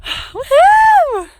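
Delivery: high, loud "woohoo" of joy; triumphant whoop. Real, not acted. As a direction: whoop from the mountaintop at full voice. Celebrating alone on the summit.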